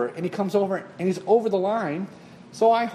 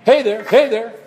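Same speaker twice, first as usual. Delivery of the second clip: loud high hey there, hey there; a friendly heads-up call; friendly warning; 6 semitones higher, 9 dB louder than usual. Retold or imitated. Middle horn setting, loud friendly warning. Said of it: "something that's like".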